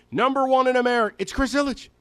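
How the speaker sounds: number one in America, fast and upbeat; countdown host. Real, not acted. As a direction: rattle it off like a radio countdown. Fast Casey Kasem countdown style.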